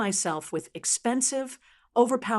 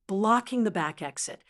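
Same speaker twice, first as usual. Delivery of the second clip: ordinary delivery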